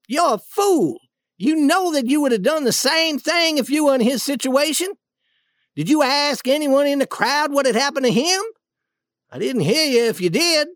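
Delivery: high-pitched, exasperated rebuke; indignant scolding. Acted. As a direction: tell him off with pointed questions. Duke scolds the angry king.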